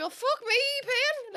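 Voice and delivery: high-pitched mocking disbelief; hammed-up incredulous jibe; mock amazement. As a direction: tease a mate with exaggerated shock. Mimicking friend's mock amazement at paying.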